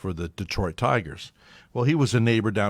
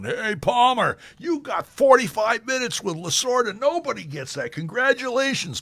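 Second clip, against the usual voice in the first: higher, lively mock press-box cheer; reporters congratulating; 12 semitones higher, 4 dB louder than usual. Press guys congratulating the reporter, higher and lively. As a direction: rib him with envy.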